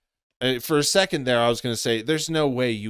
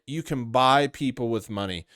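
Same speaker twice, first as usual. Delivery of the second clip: ordinary delivery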